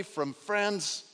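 usual speaking voice

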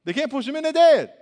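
loud emphatic shout. Acted. Preaching emphasis.

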